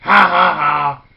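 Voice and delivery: loud villain's ha ha ha; booming wicked laugh; evil wolf laugh. Acted. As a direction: gloat with a big evil laugh. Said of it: "the big bad wolf went".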